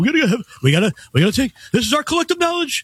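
high-pitched ranting mock of Bernie; mock Bernie Sanders. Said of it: "people like Bernie Sanders go".

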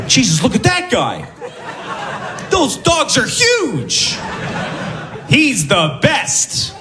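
high, slow admiring shout; excited crowd shouting. Retold or imitated. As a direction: cheer him on like an amazed crowd. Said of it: "They're going".

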